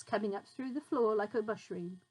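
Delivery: plain reading voice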